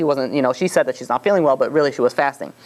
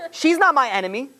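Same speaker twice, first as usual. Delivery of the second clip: high, pleased self-assurance; smug Haman; 9 semitones higher than usual. Retold or imitated. Haman reassuring himself about Esther, high smug voice. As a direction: lift into Haman's smug relief.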